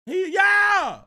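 high, loud cheer; excited shout back. Retold or imitated. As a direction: holler back hyped at the fan. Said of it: "turned around and went".

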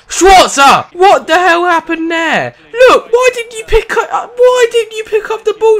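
high, loud, half-laughing rant at the keeper; exasperated yelling. Real, not acted. Player rants at his goalkeeper, loud laughing exasperation. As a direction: yell at the goalkeeper in disbelief.

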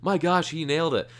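thrilled praise for a classmate nailing Sully; excited gushing. Retold or imitated. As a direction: gush like you just heard a great performance. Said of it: "I was like".

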